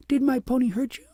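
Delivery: put-on a small girl worried she caused hurt; sweet child asking gently but high; little girl voice. A narrator's reading.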